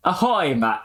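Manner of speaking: attempted Aussie accent, pitched high; put-on Strayan twang; Australian accent. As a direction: try a hearty Australian ahoy. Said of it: "in a fucking Strayan accent".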